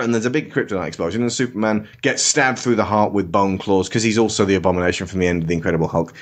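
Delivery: ordinary delivery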